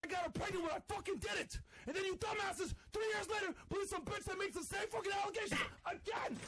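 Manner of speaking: high shouted rant; angry shouting. Real, not acted. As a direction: yell the accusation back. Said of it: "Totally not mad at all".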